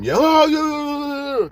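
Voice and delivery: sarcastic imitation of an overexcited guy; mock hyped yelling. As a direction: yell "yo" over and over, mocking. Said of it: "He said, "Man, calm down." As if I'm".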